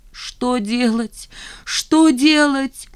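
rising, slow anguished "что делать"; panicked and lost, pleading with herself; desperate cry. Acted. Nina alone after the wedding, desperate cry.

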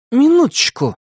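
high, indignant call to stop the story; a boy's irritated «Минуточку!»; displeased interruption. A narrator's reading. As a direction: cut in, annoyed. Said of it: "раздался недовольный голос Тима".

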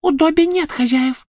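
very high, piping retort; squeaky house-elf. A narrator's reading. Elf answering the witch that he has no masters, squeaking. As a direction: squeak back defiantly. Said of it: "пропищал он в ответ".

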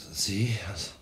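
hushed, groggy whisper; just woken, barely awake; drowsy half-whisper; sleepy whisper. Acted. Woken at three in the morning.

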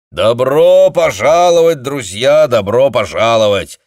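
loud, high booming greeting; booming welcome. A narrator's reading. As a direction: boom it out to the room. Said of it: "громогласно провозгласил он".

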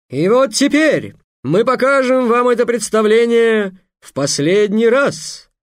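loud, high, grand showman announcement; booming circus ringmaster. Acted. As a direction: proclaim it to a big crowd, slowed on titles. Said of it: "произнёс Майхель". (громкий торжественный конферансье)

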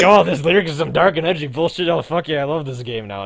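high, loud mock excited fan; hammed-up edgy fan voice. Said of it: "it's like".

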